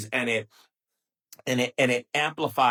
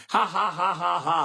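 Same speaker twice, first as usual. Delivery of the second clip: mock laugh; 3 semitones higher than usual. Mocking own past self.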